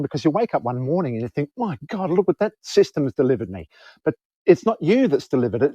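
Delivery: normal talking voice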